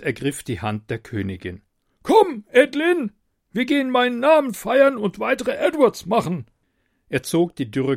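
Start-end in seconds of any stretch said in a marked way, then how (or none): 2.0-6.5 high, loud, lordly king voice; pompous tyrant king. A narrator's reading. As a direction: boast like a vain king.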